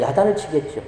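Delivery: ordinary talk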